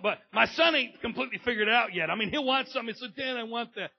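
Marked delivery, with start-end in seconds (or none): 3.2-3.9 put-on high, eager kid request; mock kid asking dad. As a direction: ask like a wanting boy. Son asking for something.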